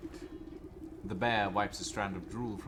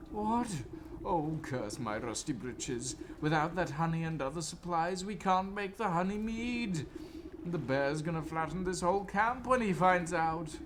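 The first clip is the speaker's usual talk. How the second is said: higher, fretful officer; flustered officer voice; 8 semitones higher than usual. Acted. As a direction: fret like a harried officer. Officer frets about the bear.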